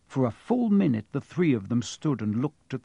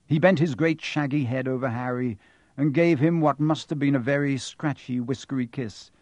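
plain reading voice

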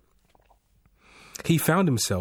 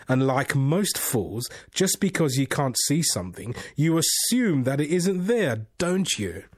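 teasing unseen voice; 5 semitones higher than usual. Acted. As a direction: toy with him, playfully smug. The voice scolding.

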